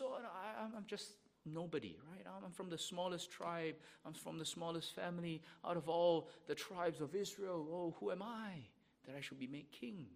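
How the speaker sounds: put-on a young Saul protesting he is nobody; humble Saul. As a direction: shrink into false modesty as young Saul. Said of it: "those words of humility".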